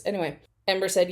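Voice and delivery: ordinary delivery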